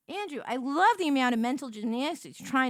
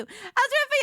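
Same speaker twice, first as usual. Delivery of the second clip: diner waitress; 11 semitones higher, faster than usual. Acted. Repeats line in waitress voice.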